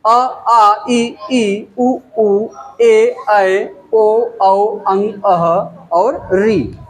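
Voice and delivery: अ, आ, इ, ई one by one, each held; slow alphabet recital, अ through री, each separate; reciting Hindi vowels. Shown as a demonstration. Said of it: "स्वर अ से अह तक".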